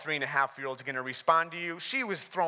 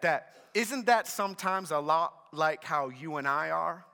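ordinary delivery

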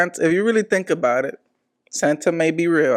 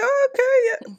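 put-on naive kid. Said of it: "you're like".

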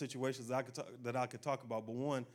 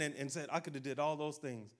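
ordinary delivery

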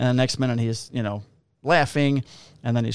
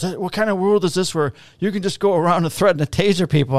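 imitating indignant drunk; 7 semitones higher than usual. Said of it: "he goes".

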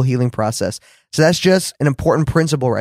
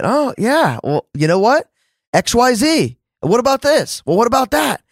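higher-pitched retold challenge, not shouted; mock indignant; 7 semitones higher than usual. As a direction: throw out grievances, each pitched up high. Retold argument with God.

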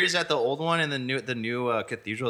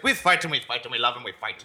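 put-on Irish accent. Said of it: "what is the Irish".

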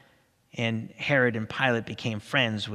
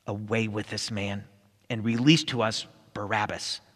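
ordinary delivery, nothing marked

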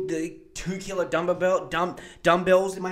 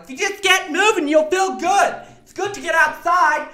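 much higher and louder, hammed-up cheery voice; boomer power walker; 14 semitones higher, 10 dB louder than usual. Mocking boomer power walkers, shrill-high hammed-up cheer. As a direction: squeal the fitness advice like a boomer jogger. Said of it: "being like".

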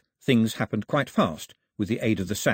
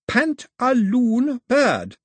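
put-on very slow, much higher, louder, like to a baby; very slowly; 13 semitones higher, 6 dB louder, much slower than usual. A narrator's reading. Daphne spelling the name out slowly for Mao. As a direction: stretch out "Pantaloon bird" syllable by syllable. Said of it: "she said very slowly".

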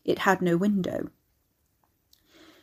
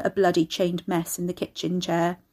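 ordinary delivery, nothing marked

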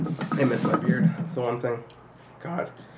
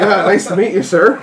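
higher, louder, over-polite greeting; mock-polite introduction, pitched up; nervously polite greeting; 6 semitones higher, 6 dB louder than usual. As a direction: act out an eager, awkward hello.

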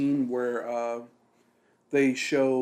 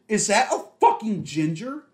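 louder, higher mock blurt; 7 semitones higher, 7 dB louder than usual. Friend imitates Ashley loudly spotting a ginger, mock loud blurt. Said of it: "So loud".

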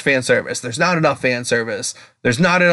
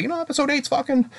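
defensive retort; 11 semitones higher, faster than usual. Retold or imitated. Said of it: "I was like".

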